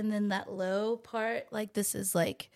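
calm the caller, unhurried; soothing customer service voice. Shown as a demonstration. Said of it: "I have a customer service voice".

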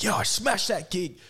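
tipsy boast. Retold or imitated. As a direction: slur it like a pleased drunk after a gig. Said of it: "You'd be like".

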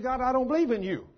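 defiant retort. Retold or imitated. Defiant sinner answers God.

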